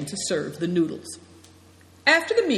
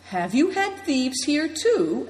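slow, higher questioning voice of Affliction; character voice; 9 semitones higher, 3 dB louder, slower than usual. A narrator's reading. Affliction asks Naidong about thieves, slow and high. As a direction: ask it slowly, pitched up.

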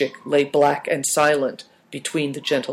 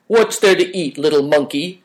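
amiable. A narrator's reading. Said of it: "asked Greencoat amiably".

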